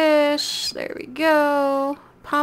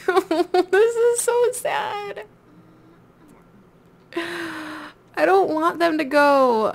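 mock whining; 5 semitones higher, much slower than usual. Mock-sad over the Sims dying.

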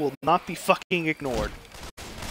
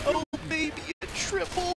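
high, soft mock cheer; gleeful zombie voice; 12 semitones higher, 6 dB quieter than usual. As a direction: squeal it like a delighted zombie. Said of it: "The infected literally just said".

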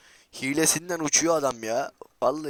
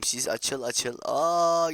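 normal talking voice

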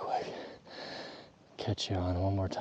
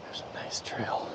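whispering; 7 dB quieter than usual. Real, not acted. Stalking elk, keeping quiet.

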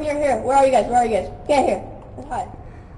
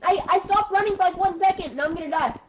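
being chased; 6 semitones higher than usual. Real, not acted. Chased by monster.

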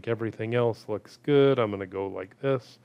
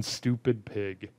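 usual speaking voice

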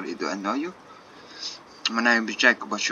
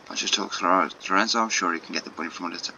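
usual speaking voice

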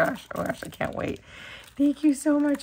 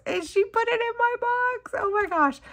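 excited squeal; 14 semitones higher than usual. Real, not acted. Crafter unboxing a friend's gift, squealing high with joy.

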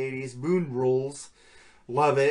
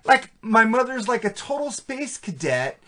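put-on Valley Girl voice; 6 semitones higher than usual. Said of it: "Valley Girl talk".

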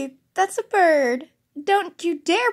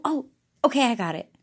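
ordinary talk, nothing marked

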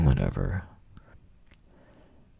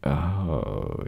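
ordinary delivery

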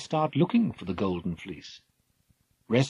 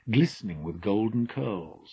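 usual speaking voice

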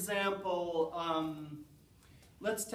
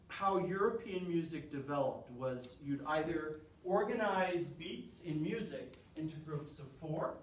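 ordinary delivery

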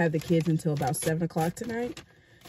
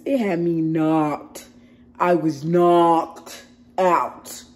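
"knocked" stretched long and loud; emphatic KNOCKED OUT; 8 dB louder, much slower than usual. Real, not acted. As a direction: lean hard and slowly on "knocked out". Raving about strong night-time medicine.

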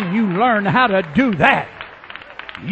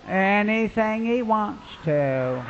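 slow, soft mock-passive answer; vague reply; 5 dB quieter, slower than usual. People answering the preacher's question, slow and indifferent. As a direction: drag it out softly, as if indifferent.

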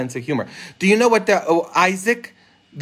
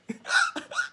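high, softer burst of laughter; 8 dB quieter than usual. Real, not acted. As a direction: crack up at your own joke. Laughs at own punchline.

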